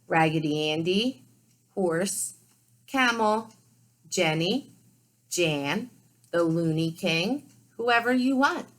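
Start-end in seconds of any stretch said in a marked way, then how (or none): none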